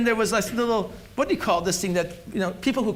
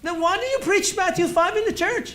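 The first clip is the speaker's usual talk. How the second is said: preaching; 5 semitones higher, much faster than usual. Acted. Preaching rhetorical questions.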